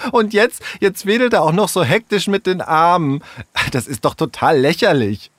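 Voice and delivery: high-pitched, derisive ridicule; mocking. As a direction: make fun of him, excitedly. Sarah ridicules Tom's waving arms. (spöttisch, mit hoher Stimme)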